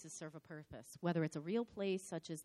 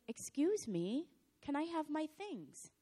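slow, higher, sugary polite ask; mock-polite excuse me, drawn out; overly polite request; 10 semitones higher, slower than usual. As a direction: ask sweetly but with an edge. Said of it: "And I said".

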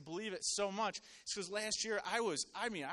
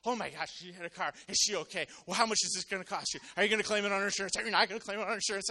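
loud, rapid-fire mock panic; imitating freaking out; 6 dB louder, faster than usual. Coworkers reacting to news of a car crash, mock freak-out. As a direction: fire off questions like panicking buddies, louder and faster. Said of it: "these guys are freaking out".

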